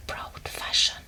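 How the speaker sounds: plain reading voice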